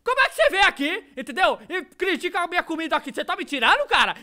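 high, loud mock scolding. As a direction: tell the boy off, hammed-up. Dubbed girl scolding the boy.

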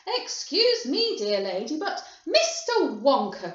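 haughty loud protest; indignant cry. A narrator's reading. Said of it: "cried Missus Salt".

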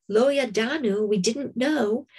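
usual speaking voice

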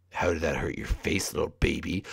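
normal talking voice

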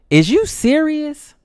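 very high, very loud slow outraged shriek; shocked shriek. Acted. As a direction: shriek in disbelief. Girl learns it's not his car.

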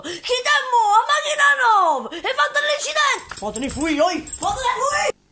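high loud Neapolitan yelling. Acted. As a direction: shout it up high. Sudden yelling at the pigs.

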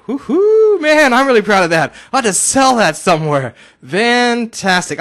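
loud, high celebratory whoop; thrilled high shout, stretching the last word; tickled, proud excitement. Real, not acted. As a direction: cheer it out, delighted with yourself. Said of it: "sorry I tickled myself a little bit".

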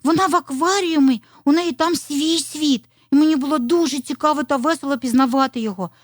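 high, loud, bubbly little fox; excited telling. A narrator's reading. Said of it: "захопливо розповідала маленька лисичка".

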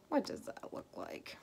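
low breathless whisper; hushed, puzzled whisper; whispered aside. Real, not acted. Aside about the costume.

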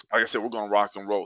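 ordinary talk, nothing marked

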